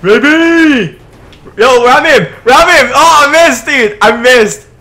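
loud, high-pitched gamer shouts; excited yelling. Real, not acted. Player calls to ram him, loud excited yelling. Ramming rivals in game.

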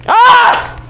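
short high-pitched yelp; startled yelp. Real, not acted. Gamer caught off guard mid-game, startled yelp. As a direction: cry out in sudden alarm.

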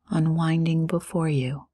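ordinary talk

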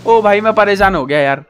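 ordinary talk

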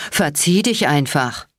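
usual speaking voice

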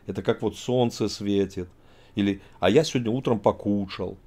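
ordinary talk

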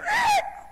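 short, high-pitched screech; imitated tire screech. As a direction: mimic a car screeching in. Imitating car pulling in.

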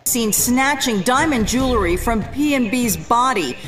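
normal talking voice

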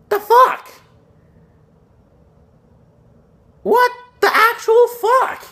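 high-pitched, indignant squawk; outraged disbelief. Real, not acted. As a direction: protest in high, drawn-out disbelief. Outraged at a card's typing.

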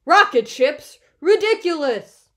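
loud, high indignant outburst. A narrator's reading. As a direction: cry out in scornful disbelief. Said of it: "Bear's voice was getting louder".

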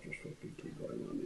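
whispered keep-going; under-breath nervous push; a hushed whisper; hissed urging. Retold or imitated. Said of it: "it was just like".

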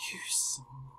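hissed under the breath; whispered curse. Real, not acted.

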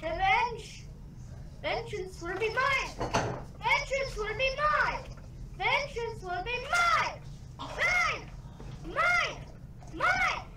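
mock-dramatic avenger, high and building; hammed-up high cries of vengeance; vengeful vow. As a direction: swear revenge, pitch climbing with each repeat. Mock villain vow after being killed.

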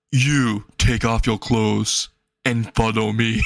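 low, slow and eerie order; creepy command. Acted. Stalker girl ordering the boy to follow, low and slow.

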